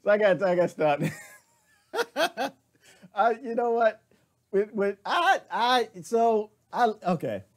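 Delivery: high, breathless laughing restarts; can't get the words out for laughing; flustered laughing. Real, not acted. Host cracking up after the president clip, laughing.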